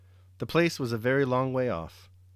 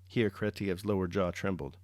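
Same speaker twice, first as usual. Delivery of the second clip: ordinary delivery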